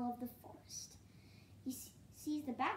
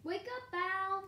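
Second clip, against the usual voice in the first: high, loud wake-up call; magpie's high, carrying cry; 8 semitones higher, 8 dB louder, slower than usual. A narrator's reading. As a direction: call out sharply to rouse a sleeper. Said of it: "calls the magpie".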